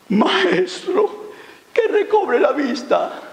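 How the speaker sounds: high, drawn-out plea; pleading blind man. Acted. Blind man asking Jesus for his sight, high pleading. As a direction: beg in a high, stretched voice.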